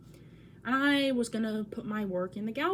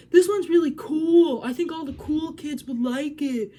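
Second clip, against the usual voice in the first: loud, higher gushing mock teacher voice; mocking the art teacher; 4 semitones higher, 9 dB louder than usual. Mocking the awful art teacher praising the drawing, loud and gushing. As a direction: overdo a pushy teacher's fake praise. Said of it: "And she said".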